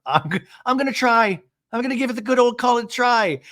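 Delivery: high, hammed-up resolve; Shannon. Recapper re-enacts housewife's promise, high mock determination.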